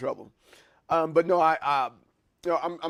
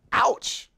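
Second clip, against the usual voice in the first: sharp unvoiced ouch of pain; sudden real ouch; pained yelp; 8 semitones higher, 6 dB louder than usual. Real, not acted. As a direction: yelp as you hit your finger. Hit finger, real pain yelp.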